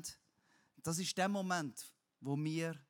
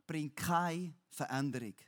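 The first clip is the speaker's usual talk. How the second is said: normal talking voice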